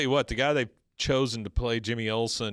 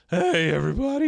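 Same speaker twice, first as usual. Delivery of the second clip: high, loud cartoon-teen squeak; Simpsons kid voice; 6 semitones higher, 6 dB louder than usual. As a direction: greet the room like a squeaky adolescent. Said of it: "Like the guy on The Simpsons".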